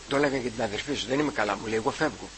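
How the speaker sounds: a soft, failing voice on his deathbed; dying father, faint. Retold or imitated. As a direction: speak it softly as a father near death. Dying father speaks to son.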